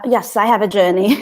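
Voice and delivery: normal talking voice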